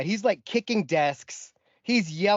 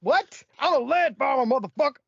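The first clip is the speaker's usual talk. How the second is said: mock indignation; 9 semitones higher than usual. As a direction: play up the offended farmer. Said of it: "I'm like".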